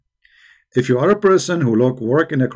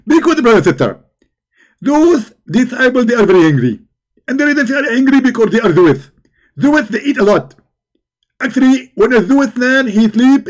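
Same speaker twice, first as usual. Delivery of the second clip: higher, louder excited preacher impression; Zakir Naik; 6 semitones higher, 3 dB louder than usual. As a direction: lecture like a fired-up debating preacher. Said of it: "If we ask Zakir Naik about this story".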